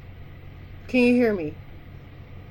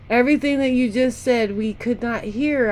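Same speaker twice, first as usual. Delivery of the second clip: ordinary delivery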